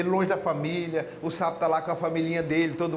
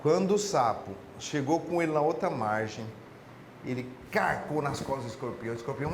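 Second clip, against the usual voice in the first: ordinary delivery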